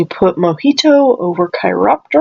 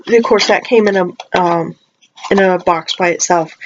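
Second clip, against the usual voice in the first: normal talking voice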